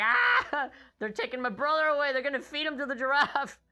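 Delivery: high, mock wailing little girl; hammed-up baby sister panic; crying little sister. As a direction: wail it like a scared toddler. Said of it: "she's crying, like".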